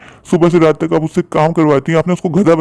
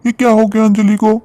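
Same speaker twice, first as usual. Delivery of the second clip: high, alarmed calling of her name; frantic husband over his fallen wife; panicked alarm; 5 semitones higher than usual. Acted. (घबराई हुई ऊँची आवाज़ में पुकार)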